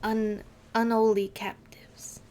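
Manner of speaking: whispered 'unholy' without the H, slow; dropped H whisper. Pretend. Streamer trying an English accent on a title, whispering 'unholy' with no H. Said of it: "you don't say the H's, right?".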